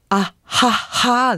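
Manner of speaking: bright open "あ、はっはー" laughed out on a lifted pitch; a sunny warm-up "あ、はっはー" that lifts into a smile; cheerful vocal warm-up. Shown as a demonstration. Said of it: "あ、はっはーやと私は思ってる". (明るい発声練習の「あ、はっはー」)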